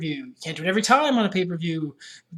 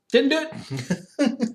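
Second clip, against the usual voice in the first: slow, drawn-out mock Clinton denial; imitating a president's famous denial, slow; Bill Clinton denial; 7 semitones higher, much slower than usual. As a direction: deny it slowly like a politician. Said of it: "absolutely Bill Clinton".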